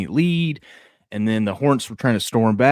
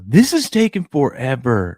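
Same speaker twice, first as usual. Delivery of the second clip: loud, slow, higher-pitched whine in imitation; complaining kid; 5 semitones higher, 4 dB louder than usual. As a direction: drag it out like an impatient child. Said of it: "was complaining".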